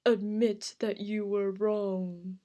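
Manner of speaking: slow, drawn-out monster voice; creepy monster. Acted. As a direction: draw it out slowly like a creepy monster. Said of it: "in the creepy monster voice".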